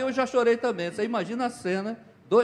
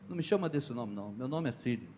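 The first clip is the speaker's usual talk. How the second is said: teary; 6 semitones lower, 9 dB quieter than usual. Retold or imitated. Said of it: "os olhos encheram d'água".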